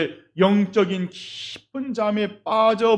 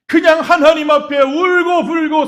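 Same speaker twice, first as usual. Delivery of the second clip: preaching at full force; 7 semitones higher, 8 dB louder than usual. Acted. Preaching climax.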